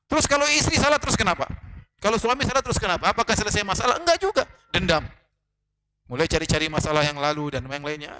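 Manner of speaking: ordinary talk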